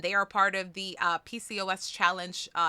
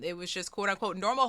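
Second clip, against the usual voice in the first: ordinary delivery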